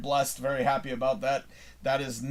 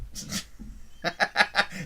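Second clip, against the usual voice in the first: airy unvoiced chuckle; breathy laugh; 4 semitones higher, 5 dB quieter than usual. Real, not acted. Joking about Eric's mom watching, soft airy laugh.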